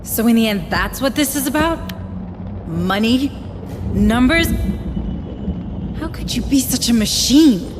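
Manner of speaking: rising, accusing, upset; outraged disbelief. Acted. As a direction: confront him, appalled. Maiko confronts villain, outraged.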